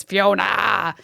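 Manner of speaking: Fiona slowed, with a long "ahh" at the end; drawn-out final ah. Shown as a demonstration. Comic joking about signing documents as Fiona, final "ah" stretched out long. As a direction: stretch the last vowel of Fiona into an exaggerated "ahh". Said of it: "anything I have to sign".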